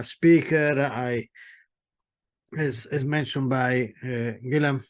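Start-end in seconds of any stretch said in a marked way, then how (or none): none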